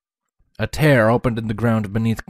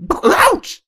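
sharp high yelp of pain; pained shout; 8 dB louder than usual. Acted. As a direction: cry out as if hit on the head.

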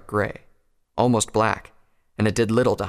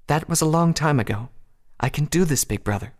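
high, assured feminine voice; high, then softening at the end; determined princess; 7 semitones higher than usual. A narrator's reading. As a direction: reassure a brother in a high voice. Princess reassures her brother.

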